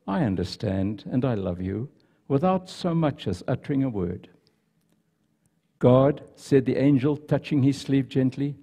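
soft, hushed storytelling read; story narration. Acted. Pastor reading a story to the congregation, softly told. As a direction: read the tale gently to the congregation.

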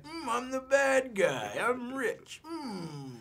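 high, slow, hammed-up smug villain purr; mock Bond villain. Actor mocking how Bond villains talk, hammed-up villain voice. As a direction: ham up a cartoon Bond baddie. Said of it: "Unless you're in a James Bond movie".

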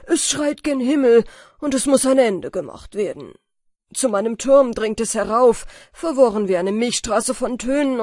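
loud, urgent plea to the king; an outraged old stargazer begging for relief; pleading astronomer. Acted. Astronomer pleads with the king, loud and urgent.

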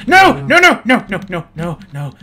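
high, loud frantic nos; shrieking no as the cow runs; panicked no. Real, not acted. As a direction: shout no over and over in panic.

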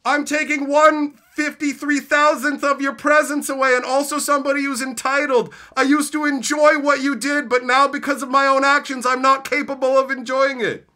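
high, loud mock whine; mocking viewer. Streamer mocking an entitled viewer, high loud whine. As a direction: play the entitled quitter, whining high. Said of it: "you're like".

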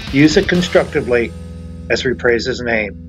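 ordinary talk

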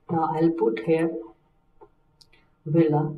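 ordinary delivery

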